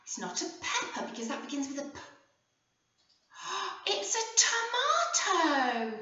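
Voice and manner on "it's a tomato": delighted gasp. Acted. Surprised discovery for children.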